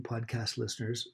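usual speaking voice

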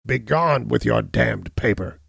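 the hunchback refusing to sign; angry cry. Acted. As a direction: spit it out with an oath. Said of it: "he cried".

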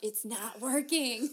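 loud, drawn-out complaint; slow, loud telling-off played for laughs; mock exasperation. Teacher calling out a coworker's bad whispering, mock exasperation.

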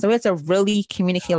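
ordinary talk, nothing marked

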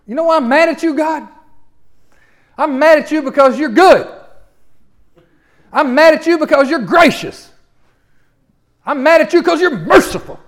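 mad, high, loud accusation; Jonah. Acted. Jonah blaming God for being good, loud anger. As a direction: hurl each grievance angrily at God. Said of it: "he gets mad talking like that".

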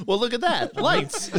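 mock alarm. Mock alarm at police lights.

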